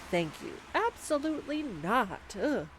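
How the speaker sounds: plain reading voice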